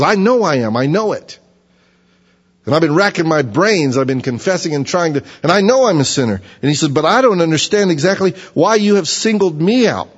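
high, loud, pleading outcry; anguished confession. Acted. Job confessing to God yet protesting, high and loud.

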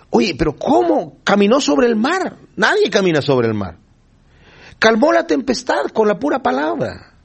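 high, astonished questioning; lightly voiced bewildered disciple asking; amazed disciples. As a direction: marvel at it in disbelief. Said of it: "Y por eso dice".

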